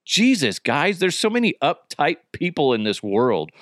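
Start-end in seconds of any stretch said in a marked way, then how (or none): none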